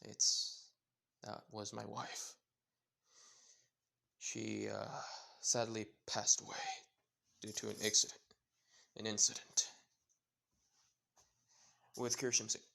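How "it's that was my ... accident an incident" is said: sad. A narrator's reading. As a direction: speak of the late wife gently, understated. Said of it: "sadly looks there and saying".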